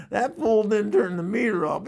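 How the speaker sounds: ordinary talk, nothing marked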